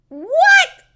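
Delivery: high, loud, stretched yelp; real incredulous squawk; shocked disbelief. Real, not acted. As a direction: blurt it out, astonished. Astonished by the slang.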